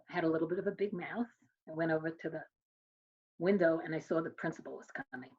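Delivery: ordinary delivery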